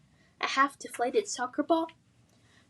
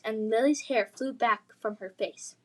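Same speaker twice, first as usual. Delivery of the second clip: plain reading voice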